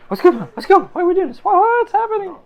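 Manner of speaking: high, frantic crew member; panicked. Acted. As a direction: squeal it in rising panic.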